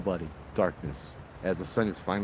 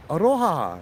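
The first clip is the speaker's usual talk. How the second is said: cheerful aloha; 7 semitones higher, 4 dB louder than usual. Retold or imitated. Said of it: "happy, big smile".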